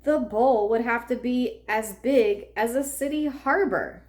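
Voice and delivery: ordinary delivery, nothing marked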